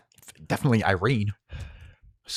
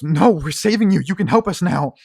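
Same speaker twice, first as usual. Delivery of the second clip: much higher and louder, refusing; insistent shout; 10 semitones higher, 11 dB louder than usual. Acted. Cersei refusing to leave Harlan behind. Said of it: "Cersei goes".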